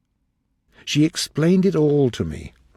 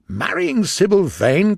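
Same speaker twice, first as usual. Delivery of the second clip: higher, louder, slow incredulous question rising on Sybil; amazed cry; 6 semitones higher, 5 dB louder than usual. Acted. Lord Henry echoes Dorian's news, amazed and rising. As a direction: echo it back in disbelief. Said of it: "cried Lord Henry".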